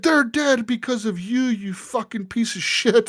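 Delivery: high-pitched imitation, stretched toward the end; mock anguished accusation. Imitated prisoner writing to blame the cop, mock anguish. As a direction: accuse in a hammed-up, grieving cry. Retells bereaved man's accusing letter.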